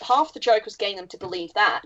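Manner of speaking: ordinary delivery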